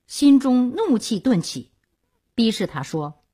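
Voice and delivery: ordinary delivery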